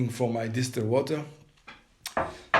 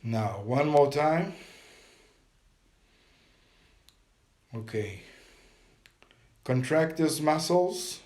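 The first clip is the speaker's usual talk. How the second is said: guided meditation. Acted. Teacher guiding viewers through a breathing meditation, calm and steady. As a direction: coach them through each breath and hold, pressing on hold it.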